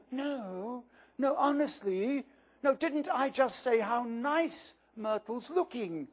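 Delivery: lightly voiced schoolgirl, protesting too much; flustered Hermione.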